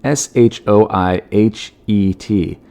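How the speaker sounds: normal talking voice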